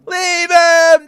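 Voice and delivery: "leave him" high and loud, pushed harder; loud whiny plea. Retold or imitated. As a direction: shout "leave him" as the begging peaks. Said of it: "And I'm just like".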